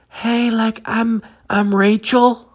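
put-on a shy teenage girl introducing herself; young girl voice. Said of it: "And then you hear it".

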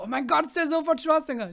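high, loud starstruck squeal; hammed-up gasping fan voice, pitched high; mock excited fans. Everyone seeing the yogurt ad, mock excitement. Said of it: "Und alle so".

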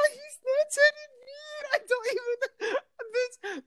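very high, teary giddy wail; overwhelmed high squealing cry; excited half-crying squeal. Real, not acted. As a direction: wail it like happy tears.

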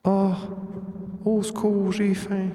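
put-on a hungry monster faking a lost child's plea; pleading creature voice. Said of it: "un bruit dans votre tête qui vous dit".

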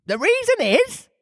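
very high squeaky creature voice; Griffin. A narrator's reading. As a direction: pipe it up high. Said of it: "said the Griffin".

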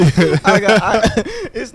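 laughter breaking into the words; laughing. Real, not acted. Still laughing while telling his friend, laughing. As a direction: talk through the giggles.

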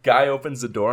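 ordinary talk

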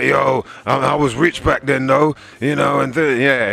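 lightly mocking braggart; boastful rich rapper. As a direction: brag like a flashy has-been. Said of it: "as opposed to just like".

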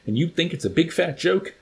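high-pitched, mildly roared challenge; roaring colonel. A narrator's reading. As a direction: throw it out as a restrained roar. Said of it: "the colonel with the big fat mustache roared".

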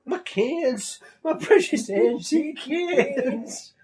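high, slow mock lament over cans; mock old woman. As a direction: wail it like a grieving old lady. Quoting distraught old woman.

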